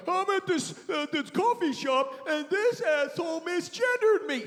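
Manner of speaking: high-pitched, hammed-up outrage; mock social-media complainer. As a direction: wail it like an aggrieved oversharer. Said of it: "announce it to everybody on social media".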